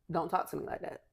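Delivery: normal talking voice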